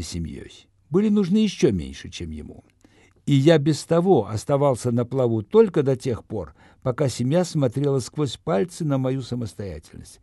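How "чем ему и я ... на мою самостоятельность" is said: plain reading voice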